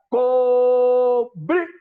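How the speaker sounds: loud held drill call "Cobrir"; shouted drill command. Shown as a demonstration. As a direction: shout "Cobrir" across the parade ground. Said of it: "do mesmo jeito que ele falou sentido". ("Cobrir!" gritado como voz de comando)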